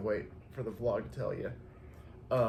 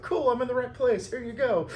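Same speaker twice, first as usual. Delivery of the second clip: much higher and louder; hasty cheery handover; 12 semitones higher, 7 dB louder than usual. Retold or imitated. Florist to customer in his truck, loud hurried cheer. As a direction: hand it over in a mock rush.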